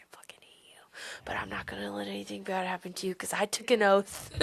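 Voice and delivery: voicing Rangi in a sharp whisper; whispered quote. Voicing Rangi's grudging oath to Kyoshi, in a whisper. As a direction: breathe it out, barely audible.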